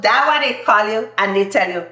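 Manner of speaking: normal talking voice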